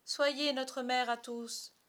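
high, loud, drawn-out plea; imploring chorus of lost boys; pleading children. Acted. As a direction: implore her slowly, like eager children. Children begging Wendy to mother them.

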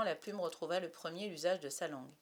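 plain reading voice

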